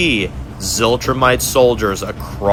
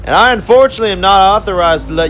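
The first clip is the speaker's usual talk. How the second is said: gloating villain conductor; 3 semitones higher than usual. Acted. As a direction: purr over the trapped prisoners like a smug torturer.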